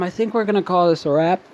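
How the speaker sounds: ordinary talk, nothing marked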